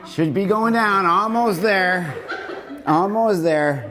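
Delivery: high, stretched coaching call; drawn-out coaching. Shown as a demonstration. Coach urging group near the bottom, drawn-out coaching. Counting down a slow exercise.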